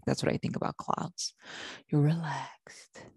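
soft breathy whisper, drifting; dreamy whisper. Shown as a demonstration. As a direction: whisper it like floating on clouds. Demonstrating relaxed cloud-like calm.

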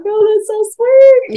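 high, drawn-out melting coo; loud, slow "aww"-style squeal; touched cooing. Retold or imitated. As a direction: gush like a mother melted by her child. Retells melting at daughter's prayer.

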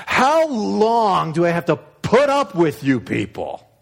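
a parent out of patience; exasperated God. Acted.

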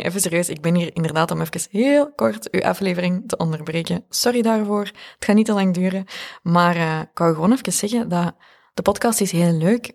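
upbeat announcer promo read. Acted. Reads a promotional ad break.

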